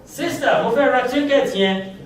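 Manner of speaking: casual Lagos street voice; Lagos market call. Pretend. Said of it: "You can say".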